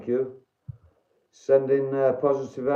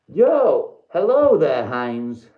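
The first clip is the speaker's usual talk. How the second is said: pitched-up mock announcer hello; theatrical greeting; 5 dB louder, much slower than usual. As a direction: greet him like a hammy showman.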